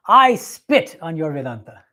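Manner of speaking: slow, loud punch on "spit"; emphatic quoted rebuke. Retold or imitated. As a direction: draw out "I spit" with force. Quoting a scornful rebuke.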